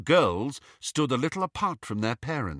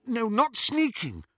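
higher, quick denial; defensive girl; 13 semitones higher than usual. A narrator's reading. Lily defending herself to Petunia, high and defensive. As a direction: defend yourself lightly.

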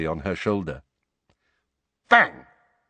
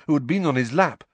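usual speaking voice

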